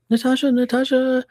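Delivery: high, sing-song call across the store. Retold or imitated. Coworker calling Natasha over from the counter, sing-song call. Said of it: "And she's like".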